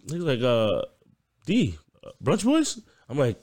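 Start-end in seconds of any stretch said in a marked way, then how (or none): 1.4-2.8 imitated curious boss. Retells boss's surprised question.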